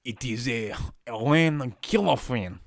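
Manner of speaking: put-on French accent on the name; French accent attempt. Shown as a demonstration. Streamer retrying a French accent, unconvinced. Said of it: "that doesn't even sound French to me".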